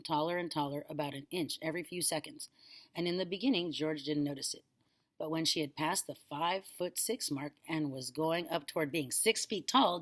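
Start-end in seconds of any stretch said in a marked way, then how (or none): none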